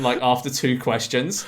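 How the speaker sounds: ordinary talk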